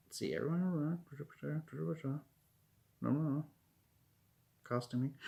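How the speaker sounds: soft absent-minded mumble; muttering to self. Real, not acted. Scanning own notes while thinking.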